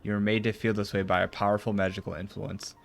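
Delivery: ordinary talk, nothing marked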